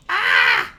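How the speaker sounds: a sharp loud gasp of shock; mock shocked gasp; dramatic gasp. Shocked reaction to scripture.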